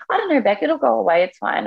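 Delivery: high, airy mock brush-off; breezy dismissal. Patient imitating ER doctors brushing her off, mock breezy dismissal. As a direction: imitate doctors waving it away, pitched high then easing down.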